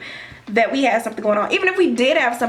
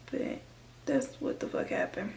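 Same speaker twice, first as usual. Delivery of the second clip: whispered aside; 14 dB quieter than usual. Real, not acted. Muttered aside after retelling.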